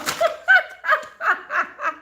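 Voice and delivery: high, bright giggling laugh; burst of laughter. Real, not acted. Laughing at chat saying she sounds Southern, high giggle. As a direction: crack up laughing at the comment.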